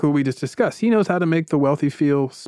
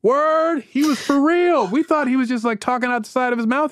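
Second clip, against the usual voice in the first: much higher, excited disbelief; mock astonished investors; amazed crowd; 9 semitones higher than usual. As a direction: gush like impressed onlookers. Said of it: "And everyone's like".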